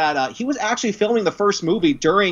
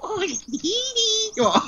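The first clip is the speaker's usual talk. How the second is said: much higher, slow sing-song call; lilting pet call; calling the cat; much slower than usual. Real, not acted. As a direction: coo it to a pet.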